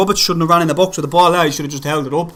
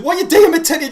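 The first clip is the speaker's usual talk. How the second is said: exasperated yell; 11 semitones higher than usual. Real, not acted. Fan yelling at his player for a bad touch, exasperated. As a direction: shout at the screen in disbelief.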